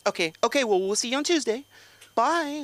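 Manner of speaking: high, quick awkward goodbye; secretary ending an awkward call; awkward receptionist. Retold or imitated. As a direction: hurry off the phone. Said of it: "And she's like".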